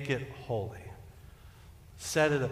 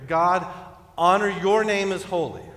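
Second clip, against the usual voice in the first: loud, raised plea to God; fervent prayer; 5 semitones higher, 7 dB louder than usual. Acted. Preacher telling God in prayer, fervent. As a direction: pray it out boldly, voice lifted. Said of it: "We're telling God in prayer".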